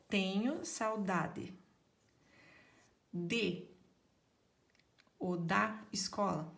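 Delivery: "Tenho saudade" drawn out slowly, word by word; slow spelling-out. Shown as a demonstration. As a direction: say it slowly, pausing before "da escola". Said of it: "eu deixo espacinho".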